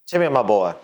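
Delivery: put-on hearty old-fashioned boss calling his worker; mild fifties boss, jovial and folksy; 1950s boss talk. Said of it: "Stop talking like you're from the fifties".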